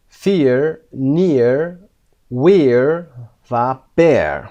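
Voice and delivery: loud clear "Fear", "near", "wear", "bear" word by word; pronunciation drill. Shown as a demonstration. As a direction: read the list out slow and loud for the class. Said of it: "pronunciation drill".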